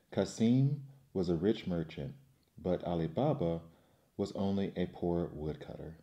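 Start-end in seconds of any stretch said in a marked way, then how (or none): none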